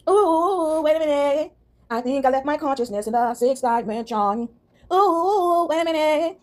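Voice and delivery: high ooh wait a minute; realizing her mind got left behind; sudden realization. Acted. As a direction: catch yourself mid-thought.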